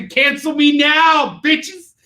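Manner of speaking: high, drawn-out shout; mock defiance. Streamer dares viewers to cancel him, mock-defiant. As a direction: yell it as a playful dare.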